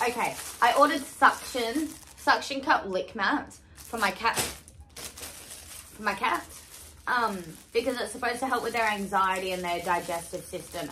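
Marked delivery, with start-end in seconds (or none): 4.4-6.5 whispered aside. Pretend. Whispered repeat.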